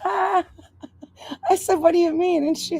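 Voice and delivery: high, breaking up with laughter; can barely get the words out for laughing; laughing hard. Real, not acted. Mother laughing at her literal daughter, high and breathless. Cracking up retelling daughter's line.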